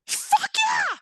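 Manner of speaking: very high-pitched squeal of joy; acted-out jubilant high cheer; elated yes. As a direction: squeal it, thrilled at your luck. Her elated answer to carpool offer.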